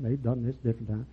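ordinary talk

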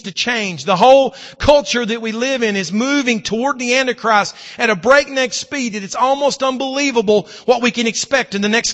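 fervent pulpit delivery; preaching. Acted. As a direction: preach it out to the congregation. Preacher at full force again.

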